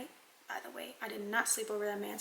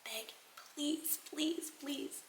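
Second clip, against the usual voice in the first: higher, pleading please please please; mock begging; 5 semitones higher, 4 dB quieter, much slower than usual. Boyfriend begging her in a mock pleading whine. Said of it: "He was like".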